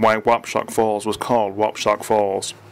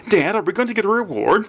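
put-on higher, childlike eager voice; a kid hoping for a prize; young boy; 10 semitones higher than usual. Boy asking his father outside the police station, childlike and eager.

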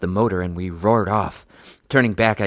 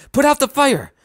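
very high, loud yell; piercingly high urgent cry; alarmed cry; 6 dB louder than usual. A narrator's reading. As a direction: shout an emergency order. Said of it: "I cried".

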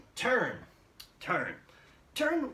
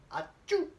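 fake sneeze; 12 semitones higher than usual. Shown as a demonstration. Teacher demonstrating a sneeze, playful mock achoo. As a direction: act out a sneeze for the class. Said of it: "What is sneeze?".

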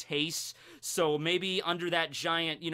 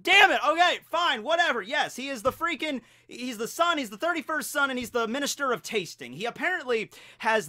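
exasperated; 7 semitones higher, 4 dB louder than usual. Real, not acted. Retold annoyed reaction to the correction.

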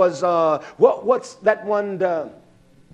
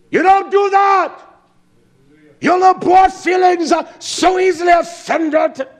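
higher and loud, a scolding blast; rebuking shout; 10 semitones higher, 5 dB louder than usual. Real, not acted. Preacher rebuking listeners for their boyish feelings, in a high loud shout.

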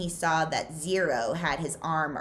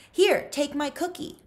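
higher, pushy teasing offer; pushy kid voice; 11 semitones higher, 4 dB louder than usual. Acted. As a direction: shove the cookie at him. Zigzag pushing cookie.

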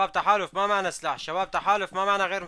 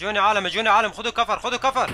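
urgent shout; 3 semitones higher, 7 dB louder than usual. Real, not acted. Ordering team to take cover.

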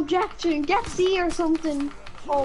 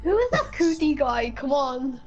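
ordinary delivery

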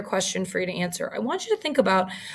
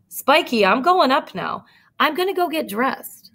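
put-on higher boyish call down to a friend; Bubba calling; 6 semitones higher, 4 dB louder than usual. Bubba calling down to Spike in the tank, higher boyish call. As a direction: call out to your buddy in the water. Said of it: "Bubba called".

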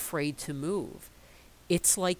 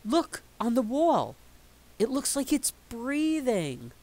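put-on excited child; 8 semitones higher, 5 dB louder than usual. Child calls out at night.

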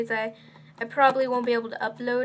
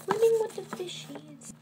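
silly voice; 8 semitones higher than usual. Pretend. Silly voice joke.

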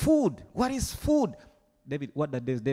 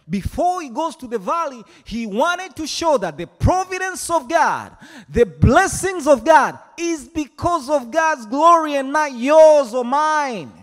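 building preaching; 8 semitones higher, 8 dB louder than usual. Real, not acted. Sermon climax.

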